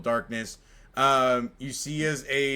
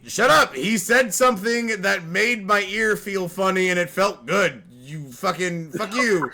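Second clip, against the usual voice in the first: loud, higher mock shouting; hammed-up indignant yelling; mock outrage; 6 semitones higher, 7 dB louder than usual. Player mock-yelling at a teasing friend.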